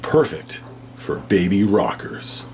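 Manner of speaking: ordinary talk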